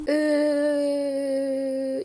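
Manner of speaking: long, held "euh" on one note; drawn-out "euh". Real, not acted. Vlogger stuck over what to cook, long droning "euh". Hesitating before the empty fridge.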